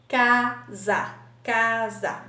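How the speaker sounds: CA - SA, a clear gap between the two beats; clap-along teacher splitting CA-SA; split into syllables. Shown as a demonstration. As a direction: say casa as two clapped syllables, CA, SA. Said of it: "Bate palminha duas vezes".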